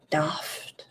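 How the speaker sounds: whispered British "Daft." with a long ah; soft mock-British "Daft."; Queen's English whisper. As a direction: breathe "Daft." like a posh Brit. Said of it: "in the Queen's English".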